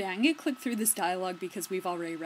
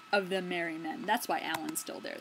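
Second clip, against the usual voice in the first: normal talking voice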